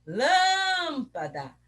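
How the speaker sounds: LÂMPADA with a long high LÂÂÂM; calling the word. Shown as a demonstration. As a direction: call LÂMPADA, holding the first syllable. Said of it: "chamando a palavrinha".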